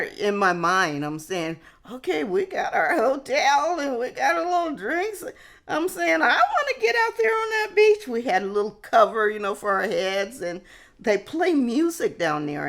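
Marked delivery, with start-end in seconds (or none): 1.9-8.1 much higher and louder, excited cry; can't wait for the beach; eager to party. Retold or imitated. Thinking only of partying while sick.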